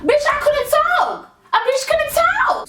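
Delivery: high, slow, pressed retelling; reliving the moment she couldn't speak; strained high emphasis. Real, not acted. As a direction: stretch it out in disbelief. Retelling choking panic.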